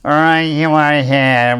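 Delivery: slow northern accent impression; Swinnerton's northern accent. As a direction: take off a northerner on the radio, unhurried. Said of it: "with his northern accent".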